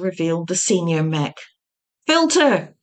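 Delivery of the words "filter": a sharp high loud call; shout. A narrator's reading. Varma shouts to the senior mech, loud and high. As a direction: yell the order down the corridor. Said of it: "shouted Varma".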